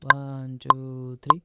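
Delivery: teacher counting along with the metronome; counting beats. Shown as a demonstration. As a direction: count "one, टू" loudly, one number per beat. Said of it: "ऐसे चलेगा".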